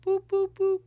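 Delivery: high, even phone beeps; imitated hang-up tone. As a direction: mimic a dead line beeping. Imitates call being cut off.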